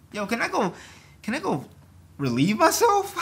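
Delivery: high, loud mock request; mock asking permission. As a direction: ask like a timid employee.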